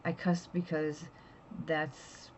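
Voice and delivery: normal talking voice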